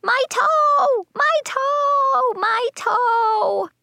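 put-on very high child's wail; drawn-out, hammed-up howl; pained holler. A narrator's reading. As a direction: holler it in exaggerated agony, way up high. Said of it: "I hollered".

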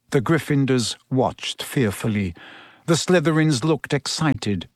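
usual speaking voice

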